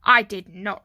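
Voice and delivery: loud, emphatic retort; firm denial. A narrator's reading. Arthur answers his brother's accusation, loud firm denial. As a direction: deny it forcefully at an even pitch. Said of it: "replied Arthur".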